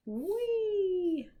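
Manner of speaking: high, loud playful whee; excited whee. Acted. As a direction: squeal like riding a seesaw. Seesaw going down in book.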